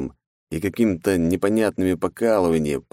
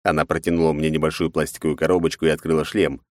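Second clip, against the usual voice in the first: usual speaking voice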